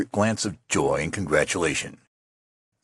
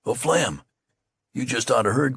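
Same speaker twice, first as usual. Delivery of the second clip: higher, eager rush of news; eager cry; 6 semitones higher than usual. Acted. Soldier hurries up with news: eager excited cry. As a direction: burst with news to share. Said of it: "cried one eagerly".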